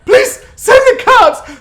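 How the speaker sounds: high, loud, slow faked pleading; mock hysterical plea. Imitating Amy begging for cops. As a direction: wail it like a fake victim.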